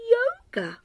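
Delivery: ordinary delivery, nothing marked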